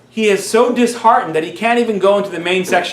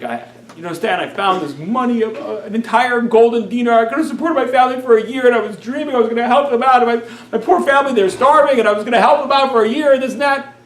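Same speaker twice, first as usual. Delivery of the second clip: put-on higher, louder, tearful pleading in a boy's voice; crying boy; 7 semitones higher, 4 dB louder than usual. Boy to the rabbi, explaining his loss while crying. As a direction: pour out the story through tears, retold. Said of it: "the boy just breaks out crying".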